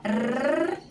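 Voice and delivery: a trilled tongue "rrr"; rolled r. Shown as a demonstration. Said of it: "vibrar a língua".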